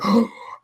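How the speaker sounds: sharp, high crowd gasp; imitated shocked gasp. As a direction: gasp like a stunned audience. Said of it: "And then everyone went".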